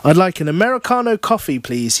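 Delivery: normal talking voice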